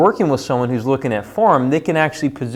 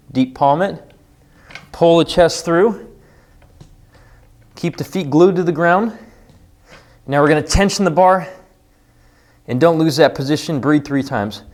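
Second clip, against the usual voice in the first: coaching cues; 4 semitones higher than usual. Shown as a demonstration. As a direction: guide them through the lift. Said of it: "coaching cues during exercise".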